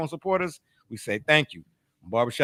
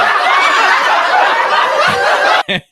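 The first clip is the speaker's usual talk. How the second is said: loud high laugh; 11 dB louder than usual. Real, not acted. Big laugh after his joke.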